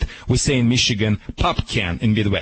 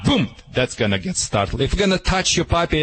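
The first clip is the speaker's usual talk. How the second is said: ordinary talk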